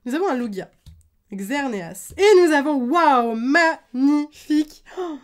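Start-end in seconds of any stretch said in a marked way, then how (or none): 2.2-5.2 loud, awed exclamation, last word drawn out; real thrilled wonder; amazed card reveal. Real, not acted. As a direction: burst out, then stretch the praise slowly. Revealing a card they love.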